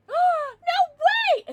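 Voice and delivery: high, loud cry of disbelief; amazed shriek. Real, not acted. Streamer hearing a viewer's first Pokemon game, shrieking in amazement. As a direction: shriek it, delighted and stunned.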